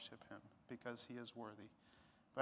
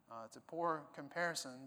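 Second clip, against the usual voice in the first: usual speaking voice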